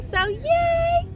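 high, delighted cheer; excited. Real, not acted. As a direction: cheer it happily. Happy not needed at work.

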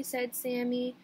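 plain reading voice

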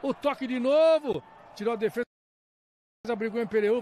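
ordinary delivery

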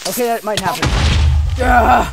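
loud, high panicked scream; panicked yell. Real, not acted. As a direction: yell as the creeper blows. Creeper about to explode.

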